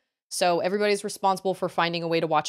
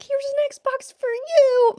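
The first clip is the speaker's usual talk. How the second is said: cheery; 5 dB louder, much slower than usual. Pretend. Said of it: "if we were suddenly like".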